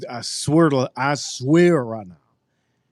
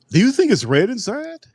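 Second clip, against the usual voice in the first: mock question; 10 semitones higher than usual. Mocking a viewer's question.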